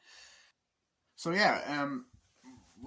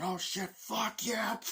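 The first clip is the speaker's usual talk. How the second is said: mock soldier cheer; 11 semitones higher, much slower than usual. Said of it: "they're like".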